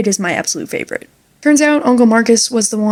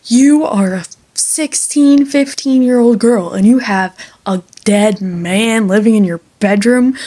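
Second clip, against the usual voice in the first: affronted priest. Retold or imitated. Priest learns of the ghost in her bedroom, affronted. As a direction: scold in mock outrage, leaning on the words. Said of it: "he gets all affronted because he's a priest".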